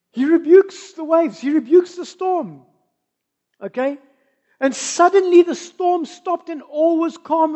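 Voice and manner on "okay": normal talking voice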